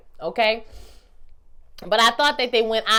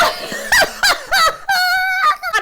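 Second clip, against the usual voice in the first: shrieking laugh; 6 dB louder than usual. Real, not acted. Laughs at her own joke.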